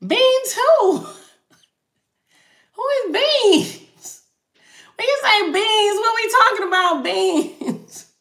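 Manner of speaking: high, laughing put-on voice of disbelief; playful high-pitched who-is-beans voice; mock bafflement. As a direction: squeak it out like the question makes no sense. Mock-baffled reaction to a viewer question.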